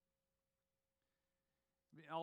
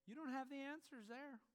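ordinary talk

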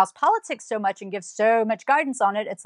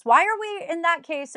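normal talking voice

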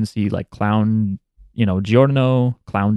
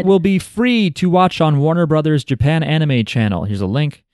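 bright, higher announcer read; announcement read; 7 semitones higher than usual. Acted. Reads out the event announcement.

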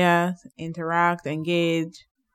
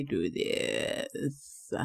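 vocal fry; 7 semitones lower, 10 dB quieter than usual. Shown as a demonstration. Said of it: "what is vocal fry".

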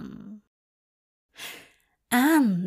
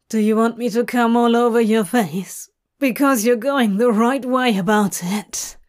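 louder and fast, urgent; 5 dB louder than usual. Acted. Crowley breathless and urgent.

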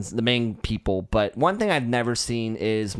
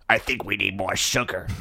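low, strange fish creature voice; lazy deep fishman murmur; fish man; 4 semitones lower than usual. Acted. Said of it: "That's your Fishman voice?".

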